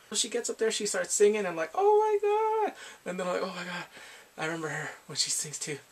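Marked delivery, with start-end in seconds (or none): 1.7-2.7 high, drawn-out gasp of amazement; hammed-up starstruck exclamation; mock awe. Acts out amazement at her singing. 3.4-3.8 soft, understated reaction; hushed little aside of amazement; subdued awe. Acted. Repeats the reaction, toned down.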